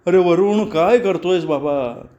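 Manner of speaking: mildly acted cry of pain. A narrator's reading. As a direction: cry out as if pinched. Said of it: "कळवळून ओरडली".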